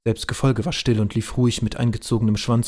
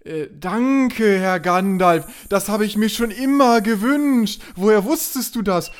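mock grateful character; 7 semitones higher, slower than usual. Character thanks for gift.

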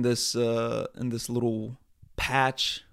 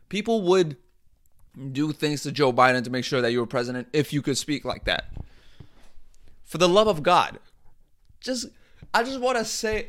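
normal talking voice

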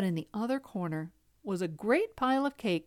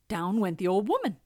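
usual speaking voice

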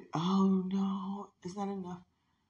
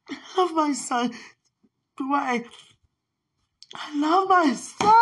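higher and louder, bursting out; emotional outburst; 8 semitones higher, 4 dB louder than usual. Real, not acted. Mother overcome with love for her son, crying it out loud. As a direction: cry it out to the room.

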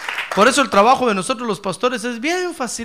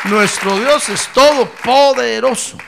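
gritando gloria, muy alto y agudo; proclámalo con todo el fervor; preaching at full force; 8 semitones higher, 12 dB louder than usual. Acted. Exclamación de alabanza. (predicación a todo pulmón)

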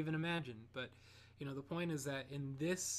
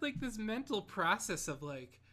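normal talking voice

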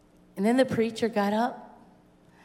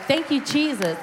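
higher-pitched cry of thanks; exultant praise; 6 semitones higher, 4 dB louder than usual. Retold or imitated. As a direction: relive a burst of grateful praise. Reenacting praise after baptism.

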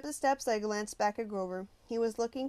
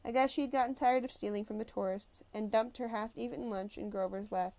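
plain reading voice

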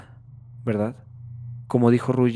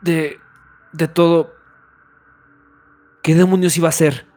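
gritando en pánico; 4 semitones higher, 10 dB louder than usual. Acted. Pánico tras el borrado.

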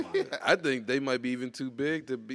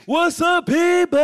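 booming radio announcer intro, higher and louder; 13 dB louder, slower than usual. Shown as a demonstration. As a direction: hype it up like a radio host. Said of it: "was gonna sound like, like an announcer".

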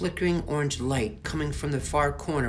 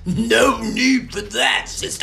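snarling; 11 semitones higher, 11 dB louder than usual. Acted. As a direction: snarl it at her sister. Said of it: "snarled Merkel".

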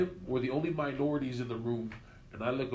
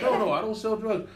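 quoting himself; 9 semitones higher, 5 dB louder than usual. Retold or imitated. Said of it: "I'm like".

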